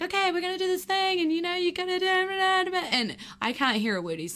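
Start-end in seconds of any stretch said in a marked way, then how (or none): 0.0-2.9 high, fast shouted instructor pep talk; instructor yelling. Retold or imitated. Skydiving instructor urging the scared jumper, high fast shout. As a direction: imitate the tandem instructor geeing you up over the wind. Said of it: "and he's like".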